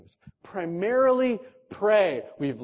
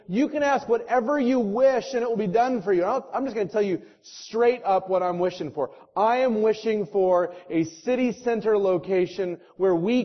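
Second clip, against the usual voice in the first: loud, raised, driving sermon delivery; full-force preaching; 4 semitones higher, 4 dB louder than usual. Real, not acted. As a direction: preach it hard, rallying the church. Preaching the building vision.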